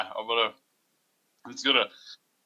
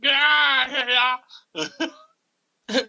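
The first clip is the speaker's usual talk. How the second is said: mock southern yell; 5 dB louder, slower than usual. Player mocks farmer voice.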